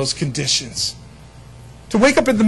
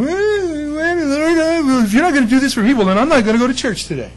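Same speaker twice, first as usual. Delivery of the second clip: raised, whiny complaint to God; mock whining; 7 semitones higher than usual. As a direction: whine like a sulky churchgoer. Whiny believer voiced.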